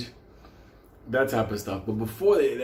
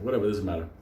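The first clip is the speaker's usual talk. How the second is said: ordinary talk, nothing marked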